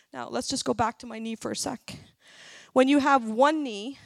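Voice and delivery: usual speaking voice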